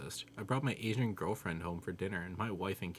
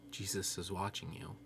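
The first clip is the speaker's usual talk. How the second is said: soft, much quieter warning; 6 dB quieter than usual. A narrator's reading. Parrot warning the burglar, in a quiet voice. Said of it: "a quiet voice say".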